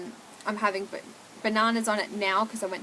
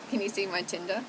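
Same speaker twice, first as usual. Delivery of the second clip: usual speaking voice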